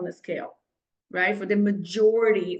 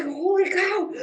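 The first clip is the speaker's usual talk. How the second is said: shocked; 8 semitones higher than usual. Retold or imitated. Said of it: "I was like".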